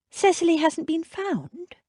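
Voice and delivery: anxious question; heroine voice. A narrator's reading. Jane voice.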